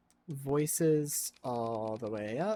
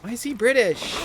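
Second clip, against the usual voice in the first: high, loud, baffled yelp; incredulous outburst; 9 semitones higher, 11 dB louder than usual. Real, not acted. Streamer still thrown by the character's accent, incredulous. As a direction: burst out in disbelief.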